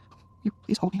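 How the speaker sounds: dying wife asking husband to hold her; tender plea. A narrator's reading. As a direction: ask to be held. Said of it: "Jenny asks to be held".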